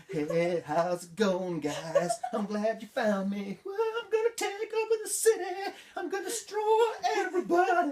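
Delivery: mock a villain too bubbly to scare; silly cheerful villain. Vlogger mocking Enchantress's threat as a high giggly squeal. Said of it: "instead of being threatening or menacing".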